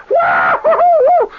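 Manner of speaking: loud high whoops of joy; whooping shout. Retold or imitated. Soldiers heard whooping at the war's end, loud and high. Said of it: "He hears guys going".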